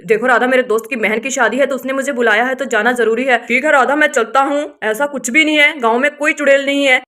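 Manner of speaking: low, loud man's voice; firm husband. Acted.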